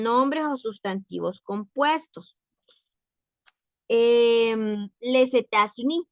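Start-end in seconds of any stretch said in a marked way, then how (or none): 5.0-6.0 les États-Unis with the S linked as Z; liaison in les États-Unis. Shown as a demonstration. Teacher modelling les États-Unis for her students. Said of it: "se pronuncia États-Unis".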